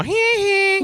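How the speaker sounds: high falsetto "hee hee"; mocking giggle. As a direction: tease in a squeaky pretend giggle. Imagines riding by, mocking fallen kid.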